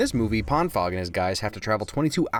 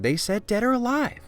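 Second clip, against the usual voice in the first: higher pitched mock fan protest; mock shouting fan; 11 semitones higher than usual. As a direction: mimic an indignant viewer, pitched up. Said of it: "you're shouting".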